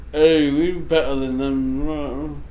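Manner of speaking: put-on slow, drawn-out British snob accent; mock British accent. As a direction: parody a superior Englishman's accent. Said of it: "they started, like".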